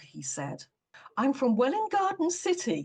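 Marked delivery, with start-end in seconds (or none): 1.2-2.8 primly, pitched up high; prim, proper little correction. A narrator's reading. Mrs Enderby primly denies her brogue to Cutter, pitched up high. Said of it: "she said primly".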